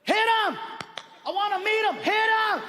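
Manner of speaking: high, frantic holler; urgent yelling. Acted. Fan begging the handler to signal Arnold, high urgent yell. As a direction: yell your demand over the crowd.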